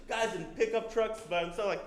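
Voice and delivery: usual speaking voice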